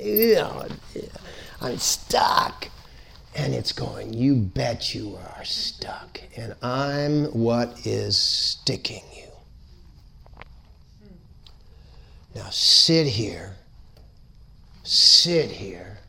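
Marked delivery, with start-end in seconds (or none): 0.0-2.7 whispered disgust. Retold or imitated. Voicing disgusted stuck person. 4.1-16.0 slow, soft menacing whisper. Acted. The blockage speaking to you, slow whisper.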